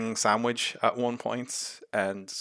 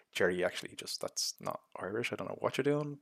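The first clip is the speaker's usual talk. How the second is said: much softer, hesitant imagined correction; hushed tactful aside; 9 dB quieter than usual. Retold or imitated. Someone on set gently correcting an actor's accent, hushed.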